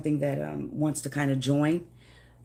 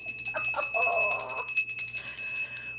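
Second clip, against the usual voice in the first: shrill giggle, much higher; high squealing laugh; 5 dB louder than usual. Real, not acted. Laughing at her own teary moment, high squealing laugh. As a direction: let out a squeaky burst of laughter. After mock crying.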